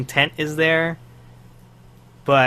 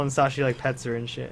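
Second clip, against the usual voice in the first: ordinary talk, nothing marked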